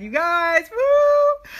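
loud, high whooping cheer; excited whoop. Real, not acted. Coach cheering to her viewers, high and loud. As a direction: cheer it out at the top of your voice. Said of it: "I'm so excited".

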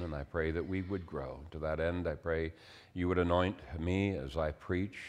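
prayer. Acted. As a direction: pray quietly over the congregation. Pastor prays before preaching.

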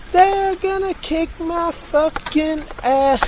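high, slow, whiny mock panic. Driver joking that the passersby will beat him, in a slow high whine. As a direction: wail it like a scared kid, slowly. Mock alarm at approaching people.

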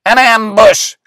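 high, loud cry of alarm. A narrator's reading. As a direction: shout the warning at once. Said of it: "cried Willis".

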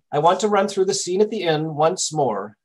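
ordinary talk, nothing marked

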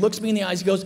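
usual speaking voice

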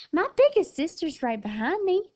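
quick-witted suggestion. A narrator's reading. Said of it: "she suggested".

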